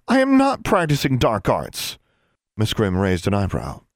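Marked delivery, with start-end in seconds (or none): 0.1-2.0 high-pitched outraged denial; indignant protest. A narrator's reading. Girl accused of dark arts, indignant.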